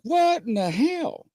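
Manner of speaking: baffled high yelp, a hint of Greg; lightly voiced baffled country boy; Greg impression. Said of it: "Greg was like".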